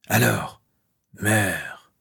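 slow, sinister sibilant threat; eerie inner voice passing sentence; hissing voice. A narrator's reading. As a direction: hiss it out with menace, pausing between words. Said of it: "la voix sifflante et lancinante".

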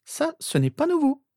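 put-on very high girl's voice; wry sister. A narrator's reading. Sister commenting on brother's readiness for anything, wry. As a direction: remark drily, pitched high. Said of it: "fit remarquer Rose".

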